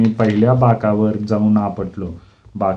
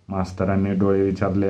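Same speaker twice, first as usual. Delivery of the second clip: ordinary delivery, nothing marked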